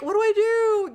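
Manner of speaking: high, loud mock panicked question. Wife imitating widowed husband lost, high mock panic. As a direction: squeal it helplessly. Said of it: "you won't be like".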